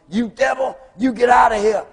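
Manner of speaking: high, loud shout over a loudspeaker; yelling at intruder. Retold or imitated. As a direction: yell to drive the devil man off. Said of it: "he's yelling at this guy".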